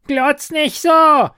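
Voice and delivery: high, loud, slow screech of an angry goblin; goblin screaming. A narrator's reading. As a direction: shriek it at her, furious little creature. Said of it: "schrie es sie an".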